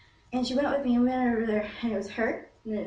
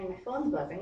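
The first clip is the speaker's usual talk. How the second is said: ordinary talk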